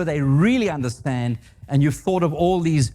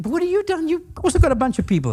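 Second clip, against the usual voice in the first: much higher and quick, accusing; exasperated accusation; 13 semitones higher, faster than usual. Retold or imitated. Speaker confronting operations guy over hires, high fast accusation.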